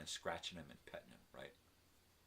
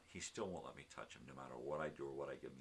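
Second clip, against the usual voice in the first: ordinary delivery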